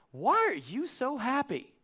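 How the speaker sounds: high, soft, drawn-out question; baffled store worker. Retold or imitated. Worn-out lady asking the positive customer, baffled. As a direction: imitate a puzzled clerk, slowly and high.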